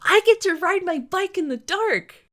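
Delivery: put-on high, loud thrilled little boy; bursting with a kid's joy; excited child. Little boy cheering about riding his bike, excited. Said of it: "lit up with excitement".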